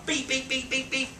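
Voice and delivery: high, chirpy imitated beeping; pager beeps. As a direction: mimic a pager going off, high and bright. Pager going off a week later.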